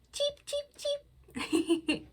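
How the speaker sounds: very high cheeps with a laugh; chick cheep. Pretend. Librarian cheeping for kids while laughing. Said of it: "It's a chick".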